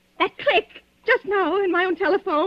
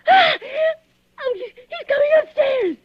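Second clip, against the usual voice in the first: high, sobbing panic; crying in terror; 10 semitones higher than usual. Acted. Woman hearing the killer on the stairs, sobbing. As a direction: cry out in fear.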